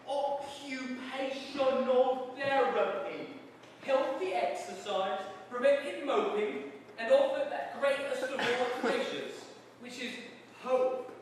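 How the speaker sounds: slow, high, loud strained guard voice; pompous jailer recalling orders; warder reciting. Acted. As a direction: dredge up the big words carefully. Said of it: "his forehead wrinkled".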